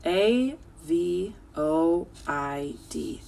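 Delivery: slow, drawn-out letter-by-letter spelling. Shown as a demonstration. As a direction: spell each letter slowly for the class.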